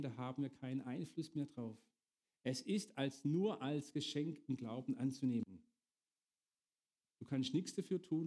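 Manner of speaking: usual speaking voice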